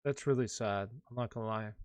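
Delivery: real grief, barely holding it together; getting emotional. Real, not acted. Streamer mourning his favorite fantasy analyst, softly emotional. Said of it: "Now I'm getting a little emotional".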